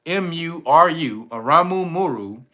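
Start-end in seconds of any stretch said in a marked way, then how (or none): 0.1-1.3 slow, clear spelling of "M U R U". Shown as a demonstration. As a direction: spell "M U R U" slowly, letter by letter. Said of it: "that's Aramu".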